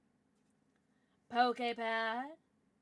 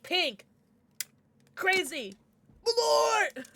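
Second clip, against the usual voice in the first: imitating viewer; 7 semitones higher, 6 dB louder, much slower than usual. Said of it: "You were like".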